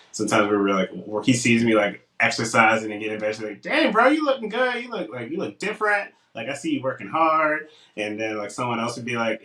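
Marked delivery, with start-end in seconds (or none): 3.6-7.7 high-pitched admiring gym buddy; hyped compliment. Retold or imitated. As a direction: hype up your friend, starting high and easing down. Retelling a friend's admiring compliment.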